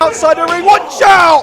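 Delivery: loud urgent ringside yell; excited shout. Real, not acted. Commentator yells at ringside action.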